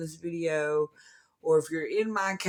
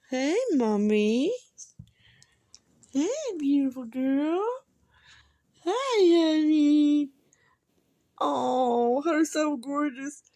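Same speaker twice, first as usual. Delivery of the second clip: sweet high pet-talk voice; 8 semitones higher, 4 dB louder, slower than usual. Real, not acted. Cooing to her dogs.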